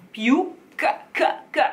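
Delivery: k said dry and strong with no air; dry k demo. Shown as a demonstration. Coach showing the dry Italian k. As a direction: clip the k hard, no breath. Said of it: "This is not a k ... with air".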